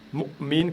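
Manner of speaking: slow mu'min, mu chopped by a closed throat; mu'min split at the glottis; glottal stop demo. Shown as a demonstration. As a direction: stop the air after mu in mu'min, then release min. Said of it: "Pareil ici".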